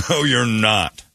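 ordinary delivery

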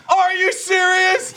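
high, slow incredulous mock voice; imitating Gavin's shocked squeal; Gavin in the background. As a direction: squeal it in disbelief. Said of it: "Gavin's in the background going".

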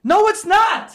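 no way, high and sharp; indignant high protest. Real, not acted. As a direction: pitch jumps up in protest. Objects to the scenario.

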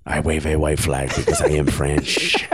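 low mock French-Argentinian accent; comic low-pitched accent, vaguely French; Karim Benzema. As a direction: explain it in a put-on, muddled foreign accent. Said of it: "I promise this is Karim Benzema".

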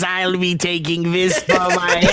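slow, laughing goblin voice. Retold or imitated. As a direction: gloat over a trinket like a goblin. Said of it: "goblin voice".

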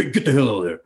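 mock stern dad telling kids off; daddy voice. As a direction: put on a gruff daddy voice. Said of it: "I'd get in my daddy voice".

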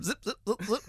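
quick little mechanical zips; imitated servo noise. Mimicking the animatronic rabbit's head, mechanical zipping. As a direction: zip like a tiny robot motor. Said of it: "the little rabbit thing".

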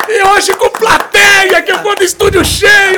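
slow, drawn-out booming announcement; announcer shout. Acted. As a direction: call him into the ring. Fight-style guest announcement.

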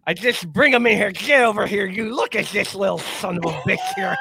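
hammed-up impression of an old football coach; Lou Holtz. Shown as a demonstration. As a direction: put on the coach's voice for a comic rant. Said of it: "do my Lou Holtz impression".

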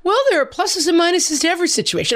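high, chipper optimist voice; imitating a relentlessly upbeat husband; cheery husband. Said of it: "He's like".

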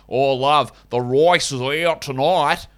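slow, hammed-up Aussie parody; terrible Australian accent. Shown as a demonstration. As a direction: drawl it in a badly faked Australian accent. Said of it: "imitating an Australian accent".

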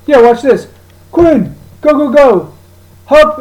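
high, loud calls to the dog; shouting dog commands. Retold or imitated. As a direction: urge the dog on across the roof.

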